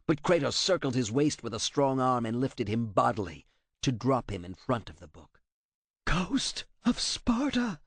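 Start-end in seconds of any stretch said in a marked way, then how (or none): 6.0-7.8 put-on high, slow, terrified old man's rasp; frightened rasp. A narrator's reading. Translator facing the Ghost of Sparta, frightened high rasp. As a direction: gasp his name out in fear. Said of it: "rasped out in a frightened voice".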